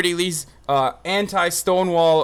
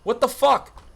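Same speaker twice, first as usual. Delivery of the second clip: ordinary delivery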